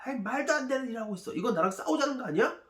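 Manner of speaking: high-pitched, fast indignant outburst; angry king. Acted. King of Israel reacting to the letter, angry. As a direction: protest in outrage, pitch jumping up. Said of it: "막 화를 냅니다".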